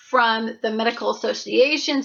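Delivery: ordinary talk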